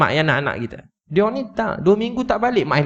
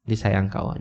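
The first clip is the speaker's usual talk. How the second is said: "Dia sayang kat awak" hushed and tender; softer tender repeat; 5 semitones lower, 6 dB quieter than usual. Retold or imitated. Repeats it softly to comfort her.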